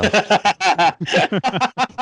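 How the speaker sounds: high, real burst of laughter; helpless high-pitched laughing; loud laugh. Real, not acted. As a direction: crack up at a friend's joke. Cracking up at the mock quote.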